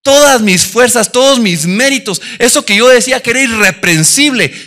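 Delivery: loud, rising sermon delivery; loud passionate preacher; fervent preaching. Acted. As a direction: preach it out with full conviction. Preaching at full force.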